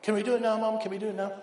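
put-on high, pleading child voice; breathless kids begging mom at dinner; eager little kids. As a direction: beg like an impatient little kid. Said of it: "kids begging again".

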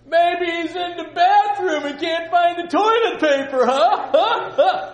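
high, loud, slow taunt with a laugh; preacher's own mocking taunt at Baal; mocking joke. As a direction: jeer while laughing. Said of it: "I would have said this".

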